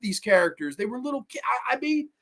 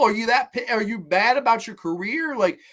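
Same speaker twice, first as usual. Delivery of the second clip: ordinary delivery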